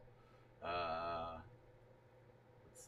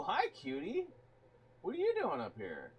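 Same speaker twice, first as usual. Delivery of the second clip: pet talk; 7 semitones higher, 6 dB louder than usual. Real, not acted. Streamer greets pet cat.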